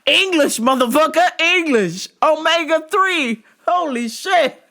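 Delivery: high, loud mock yelling. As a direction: shout it out mockingly. Mocking the guy's accent.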